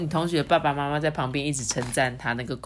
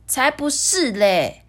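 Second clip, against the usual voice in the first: slow, louder scoffing; scornful princess; 7 semitones higher, 4 dB louder, slower than usual. Acted. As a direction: scoff at the wrong answer. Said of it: "艾莉诺就说".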